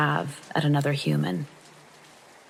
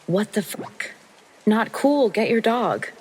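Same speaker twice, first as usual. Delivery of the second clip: higher-pitched, exasperated outburst at the dog's owner; acted-out angry demand; indignant protest; 6 semitones higher, slower than usual. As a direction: protest in alarm, pitch jumping up. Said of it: "I manage to utter".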